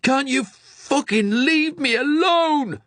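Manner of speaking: very high, loud, slow enraged yell; patient screaming at attendant, furious; furious shout. Acted. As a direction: explode in rage. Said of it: "he shouted at Sam".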